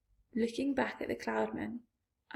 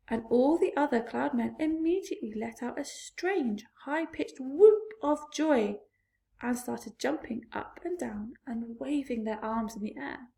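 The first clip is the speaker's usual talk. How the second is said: plain reading voice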